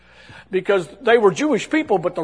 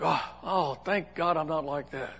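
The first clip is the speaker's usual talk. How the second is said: private thought; 2 semitones lower, 8 dB quieter than usual. Acted. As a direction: think it quietly to yourself. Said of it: "you just think".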